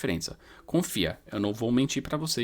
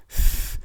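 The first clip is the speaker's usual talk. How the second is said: unvoiced th; 4 dB louder than usual. Shown as a demonstration. Teacher showing the th sound. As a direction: push air past the tongue tip. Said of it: "é o som do T H".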